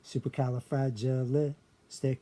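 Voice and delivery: slow, syllable by syllable; sounded out slowly. Shown as a demonstration. Teacher showing where the L sits, slowly sounded out. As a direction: draw out "Supercalifragilistic" in separate chunks, ending on stic. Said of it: "be pronounced li there".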